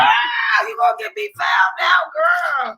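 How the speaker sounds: put-on hiss-shout at the kid in panic; frantic scolding. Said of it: "And I'm like".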